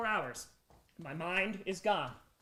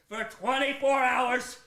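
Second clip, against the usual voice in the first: ordinary talk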